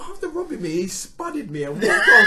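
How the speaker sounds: loud high laughing shout. Real, not acted.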